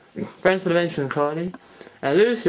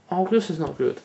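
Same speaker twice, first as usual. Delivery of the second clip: ordinary talk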